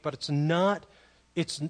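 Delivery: ordinary delivery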